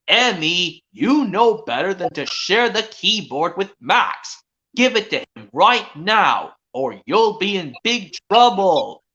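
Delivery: slow, firm scolding mother; stern mom voice. Pretend. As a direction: lay down the law slowly. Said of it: "see if I can do another impression".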